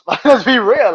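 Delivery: high, loud, laughing. Real, not acted. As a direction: laugh through it.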